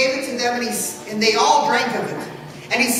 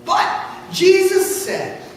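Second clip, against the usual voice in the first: plain reading voice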